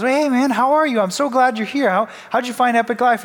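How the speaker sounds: cheery greeter. Retold or imitated. Imitates shallow church greeter.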